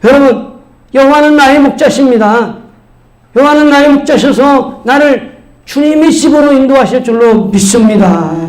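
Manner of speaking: high, projected preaching; forceful pulpit proclamation. Real, not acted. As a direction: exhort the congregation with rising conviction.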